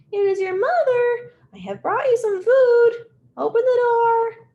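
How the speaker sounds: hammed-up fake womanly voice; leopard faking woman. Leopard pretending to be the mother, hammed-up falsetto. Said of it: "make his voice sound like a woman's voice".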